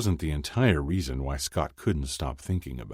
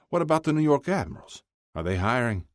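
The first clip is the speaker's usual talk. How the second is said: higher, quicker dad voice with a tease; father's voice, quick tease; 10 semitones higher, faster than usual. A narrator's reading. Dad teasing Kip about jobs, higher and quicker. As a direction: toss out the job idea lightly.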